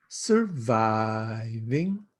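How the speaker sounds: slow drawn-out surviving with a lift at the end; three-syllable sing-song. Retold or imitated. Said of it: "in three sing-song syllables".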